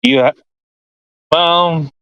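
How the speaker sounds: usual speaking voice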